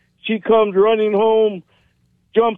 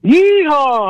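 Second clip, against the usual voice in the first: higher, louder cowboy whoop; yeehaw shout; 7 semitones higher, 4 dB louder than usual. Real, not acted. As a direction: whoop it back like a rodeo cowboy. Caller yells back the call.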